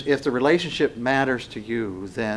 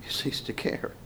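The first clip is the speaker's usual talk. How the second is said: whisper; 12 dB quieter than usual. Acted. Whispered emphasis.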